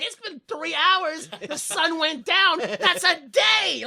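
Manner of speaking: slow, high, emphatic declarations; mock dramatic. Crew call three hours a day, mock dramatic. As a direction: spell out the absurdity, drawn out. Mocks the crew's exaggerated timing.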